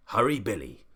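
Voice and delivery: usual speaking voice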